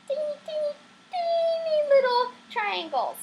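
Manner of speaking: put-on high, cutesy voice for teeny little things; cutesy tiny voice. Shown as a demonstration. Teacher reminding students how tiny vocal cords are, cutesy.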